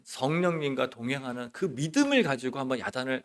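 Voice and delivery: usual speaking voice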